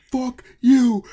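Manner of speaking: high, loud demanding yell; mock her screeching demand; shrill angry woman. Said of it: "and she was like".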